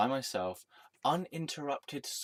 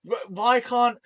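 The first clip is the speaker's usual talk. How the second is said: higher, louder mock yell at the people following him; exasperated yell; 13 semitones higher, 9 dB louder, slower than usual. Retelling his frustration, a loud exasperated yell. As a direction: throw the question out in loud disbelief. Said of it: "I'm like".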